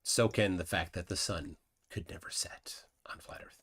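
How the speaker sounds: unvoiced whispered aside; hushed throwaway whisper. Real, not acted. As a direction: breathe it out as a secret. Aside during live chat reading.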